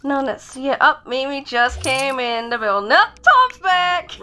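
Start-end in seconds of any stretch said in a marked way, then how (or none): 2.9-4.1 excited. Pretend. Tom arrives in pretend play.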